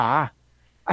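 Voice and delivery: ordinary talk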